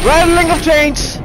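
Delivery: startled, thrilled yell at the sound; excited shout. Real, not acted. Hears the chains he predicted.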